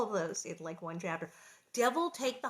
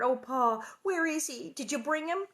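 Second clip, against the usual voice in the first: eager questioning; 6 semitones higher than usual. A narrator's reading.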